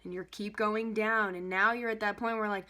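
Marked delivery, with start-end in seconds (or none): none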